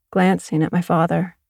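usual speaking voice

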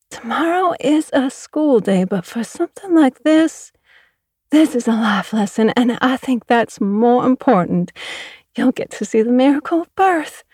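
sing-song earnest lady lecturing; gushing neighbour voice. Acted. Mother telling the farm girl birth is beautiful, high and gushing.

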